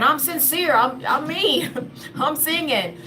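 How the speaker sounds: high-pitched, laughing delight; amused. Real, not acted. Telling listeners about singing in the elevator, amused. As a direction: let a laugh break into it, pitched well up.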